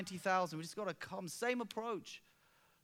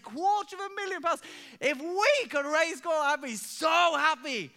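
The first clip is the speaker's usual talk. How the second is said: higher, louder, stretched-out disbelief; astonished; 9 semitones higher, 8 dB louder than usual. Retold or imitated. Pastor reacting to a leader's big target, astonished. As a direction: gasp at the huge sum. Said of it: "I said".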